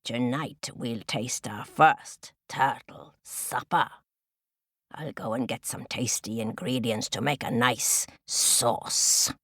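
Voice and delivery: low crow voice, savouring the plan; menacing crow. Acted. Crow promises chicks turtle supper, low gloating. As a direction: gloat over the coming feast as the crow.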